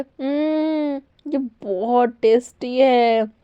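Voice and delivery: slow, relishing taste; man delighting in his craved omelette, savoring; savoring mmm. Acted. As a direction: linger over the flavour.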